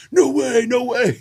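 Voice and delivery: high, amazed disbelief; can't believe their luck; amazed fan. Retold or imitated. Fans amazed.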